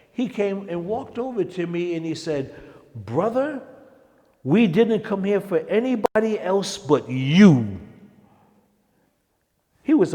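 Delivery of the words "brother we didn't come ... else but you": [name] voiced. [name] voiced, high and far.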